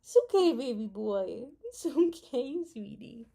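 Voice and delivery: gentle pet talk; soothing the dog. Real, not acted. Calming her dog who got upset, gentle.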